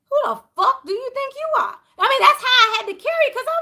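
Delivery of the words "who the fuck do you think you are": high, half-crying defiant comeback; defiant retort. Retold or imitated. Retelling standing up to bosses at work, defiant high retort. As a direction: throw it right back at them, voice near cracking. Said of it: "And I'm like".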